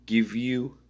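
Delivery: ordinary talk, nothing marked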